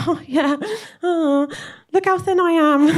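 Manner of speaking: lightly voiced breezy proud mum; mum brushing off. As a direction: play it airy and smug. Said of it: "She's like".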